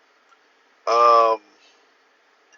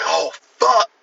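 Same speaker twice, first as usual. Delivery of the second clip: higher, louder mock panic; freaking out; 7 semitones higher, 4 dB louder than usual. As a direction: act out a pathologist's alarmed realisation. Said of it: "the pathologist is freaking out".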